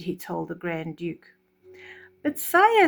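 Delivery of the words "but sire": much higher, louder protest. Acted. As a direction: nervously object to the king. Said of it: "said the Grand Duke".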